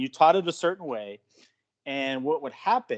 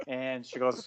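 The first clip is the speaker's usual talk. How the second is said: usual speaking voice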